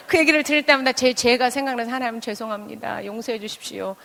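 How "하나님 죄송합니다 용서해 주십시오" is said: hushed, softly murmured apology; penitent prayer. Retold or imitated. Speaker confessing sin to God, hushed penitence. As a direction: drop to a soft, humble plea.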